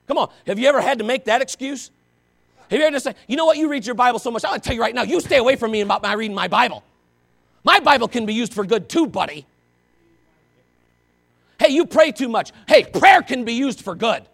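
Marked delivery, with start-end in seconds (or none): 3.3-9.5 loud, huffy comeback from a churchgoer; mock indignant retort. 11.6-14.3 mock excuse. Mimics an excuse-maker.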